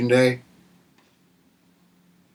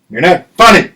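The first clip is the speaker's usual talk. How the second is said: shouted insult; 5 semitones higher, 8 dB louder than usual. Acted. Shouting at Mister Freeze.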